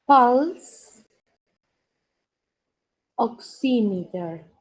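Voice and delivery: "Pulse", loud and rising, pause, then "oximeter" slowly; slow careful repeat. Shown as a demonstration. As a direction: repeat "Pulse oximeter" in two separate pieces for a student. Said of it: "¿lo puede repetir?". ("Pulse oximeter" repetido despacio, en dos partes)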